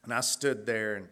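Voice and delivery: ordinary talk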